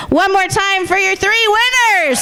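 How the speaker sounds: rousing host call; announcer. Real, not acted. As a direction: shout it out for applause. Host rousing applause from the crowd.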